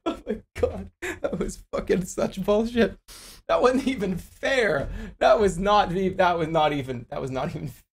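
sulky, whining complaint. Real, not acted. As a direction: whine like a sore loser. Complaining about unfair loss.

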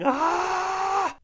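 short, very high mock shriek of outrage; mock outraged shriek. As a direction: shriek it like a scandalised cleric. Imitating outraged imams tearing clothes.

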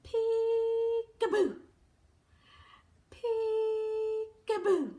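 drawn-out, sing-song peekaboo; slow playful peekaboo. Shown as a demonstration. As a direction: stretch each peekaboo long for the child.

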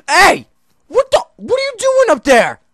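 high shocked yelling; startled outcry. Real, not acted. Player seeing a zombie up on the roof, shocked shouting. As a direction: shout in disbelief.

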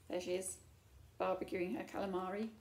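ordinary delivery, nothing marked

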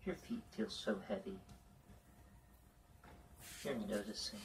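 ordinary talk, nothing marked

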